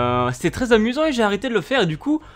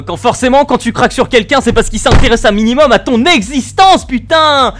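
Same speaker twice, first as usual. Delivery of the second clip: exasperated rant; 6 semitones higher, 8 dB louder than usual. Real, not acted. Ranting loud.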